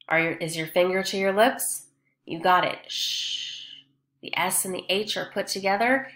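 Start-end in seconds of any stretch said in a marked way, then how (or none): 2.9-3.5 long voiceless hushing "Sh"; "Sh" alone, like hushing someone; sh sound. Shown as a demonstration. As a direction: draw out "Sh" as a pure hiss of air. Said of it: "It makes a sh sound".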